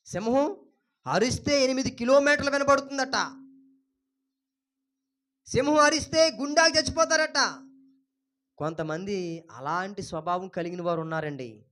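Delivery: ordinary talk, nothing marked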